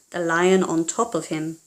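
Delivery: plain reading voice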